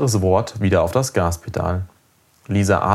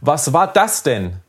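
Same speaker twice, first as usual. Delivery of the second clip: higher, drawn-out angry demand; indignant yell; 6 semitones higher, 3 dB louder, slower than usual. A narrator's reading. Startled father turning on his son, indignant high yell. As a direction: yell the question slowly in outrage. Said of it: "schrie er".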